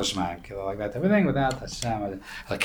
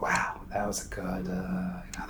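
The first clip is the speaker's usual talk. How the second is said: imitating ants, whispering "that was a good share"; mock whisper; 9 dB quieter than usual. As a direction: murmur it like tiny ants pleased with themselves. Ants praising, in a quiet scene.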